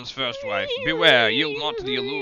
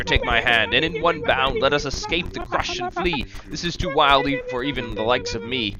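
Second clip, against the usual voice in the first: normal talking voice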